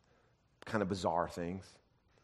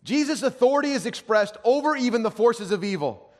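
loud, high-pitched proclamation; full-force preaching; 13 semitones higher, 12 dB louder than usual. Real, not acted. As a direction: declare it forcefully to the congregation.

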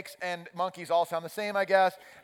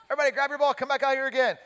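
high, loud coach's call; calling kids back; 9 semitones higher, 6 dB louder, faster than usual. Retold or imitated. Coach rounding up first graders, calling out high and loud. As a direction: call out to a scattered team.

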